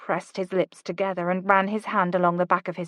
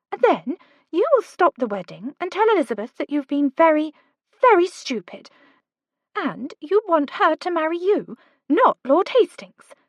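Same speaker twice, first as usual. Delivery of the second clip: put-on lightly higher, urgent young woman; Kate's voice; 8 semitones higher, 3 dB louder than usual. A narrator's reading. Kate urging Oliver to go, lightly higher voice. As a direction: push him, brisk and bright.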